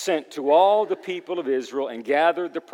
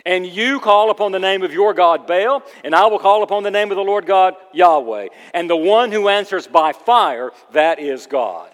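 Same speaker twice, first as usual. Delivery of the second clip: raised, ringing declaration; bold challenge; 5 semitones higher, 9 dB louder than usual. Acted. Elijah setting the test for Baal's prophets, bold and loud. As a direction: lay down the terms loud and firm.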